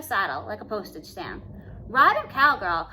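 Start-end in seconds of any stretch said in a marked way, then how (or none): 1.9-2.9 loud cheering call; crowd cheer. A narrator's reading. Crowd cheering Cindy on the bronc, loud holler. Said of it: "cried the crowd".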